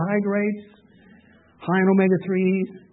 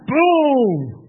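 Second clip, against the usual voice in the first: higher, louder "boom"; mock explosion boom; 6 semitones higher, 4 dB louder than usual. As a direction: toss out a boom. Imitating fire from heaven.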